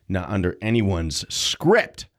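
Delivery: ordinary delivery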